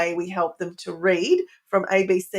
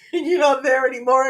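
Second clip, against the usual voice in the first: higher, louder, quicker through a laugh; 5 semitones higher, 6 dB louder, faster than usual. Real, not acted. Parent joking about parenting past death, laughing. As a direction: laugh while you talk. Laughs at own remark on dying.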